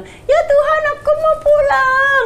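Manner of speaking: put-on high, pleading little child voice; frightened child answering God; like a small child. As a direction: beg like a scared small kid. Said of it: "Kayak anak kecil".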